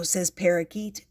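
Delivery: ordinary delivery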